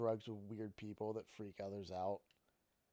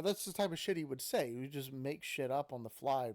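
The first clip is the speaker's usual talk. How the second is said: plain reading voice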